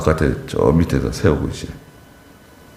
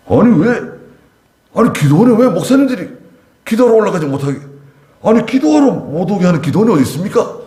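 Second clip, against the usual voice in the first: angry protest; 13 semitones higher than usual. Retold or imitated. Retelling the angry couple at the prayer house, high indignant protest. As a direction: mimic an angry man protesting, pitch up. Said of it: "뭐 화를 내더라고요".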